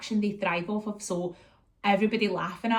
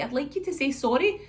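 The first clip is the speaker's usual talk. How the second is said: high sing-song mummy voice; retold parental prompting; 6 semitones higher than usual. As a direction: coax an apology from a toddler. Mum asks toddler for apology.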